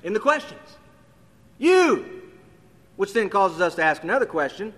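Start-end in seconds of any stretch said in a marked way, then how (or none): 1.6-2.2 "You" lifted high and louder; a high, ringing "You"; emphatic you. Shown as a demonstration. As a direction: punch the single word "You". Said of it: "emphasizes the pronoun".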